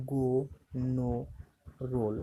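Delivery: গো, নো, রোল each with an "oh" vowel; O pronounced "oh". Shown as a demonstration. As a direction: model গো, নো, রোল with pauses, vowel "oh". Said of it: "ওর মতনই হবে". (গো, নো, রোল-এ O-এর উচ্চারণ "ও")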